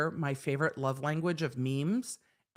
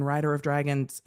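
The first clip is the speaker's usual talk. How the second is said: ordinary delivery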